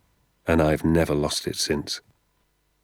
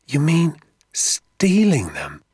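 high, loud, drawn-out horror; aghast child, slow and high; 9 semitones higher, 5 dB louder, much slower than usual. A narrator's reading. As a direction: stretch it out in shocked disbelief. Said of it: "I said, aghast".

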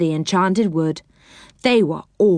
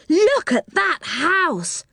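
much higher, slow and wondering; amazed cry; 10 semitones higher, much slower than usual. A narrator's reading. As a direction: stretch it out in awe. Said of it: "cried Joe".

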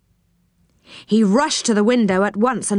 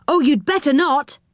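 higher-pitched urgent warning, only mildly shouted; 7 semitones higher than usual. A narrator's reading. Joe warns Rick off the window, mild warning shout. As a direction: warn him off in a raised pitch. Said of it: "shouted Joe".

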